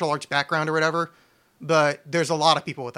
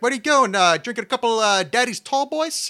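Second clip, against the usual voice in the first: high, raised movie-character voice; Harlan Williams; 7 semitones higher, 4 dB louder than usual. Retold or imitated. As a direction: imitate a movie cop's line, pitched up. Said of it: "the Harlan Williams part where he's like".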